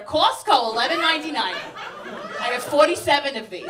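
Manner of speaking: loud, high one-word reply; hammed-up brusque auntie; rude aunt. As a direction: bat away the compliment as a rude aunt. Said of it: "Very rude. Like, my aunts".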